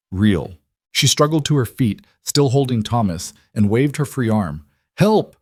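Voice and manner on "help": high, loud cry for help; shouting for help. A narrator's reading. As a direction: call out to the rider. Calling to distant rider.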